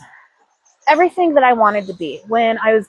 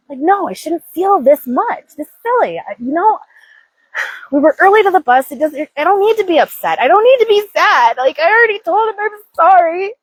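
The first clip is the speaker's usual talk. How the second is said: higher, whiny inner voice arguing back; upset inner voice; 8 semitones higher than usual. Retold or imitated. Woman voicing her own upset self, higher and fretful. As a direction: play the flustered part of yourself.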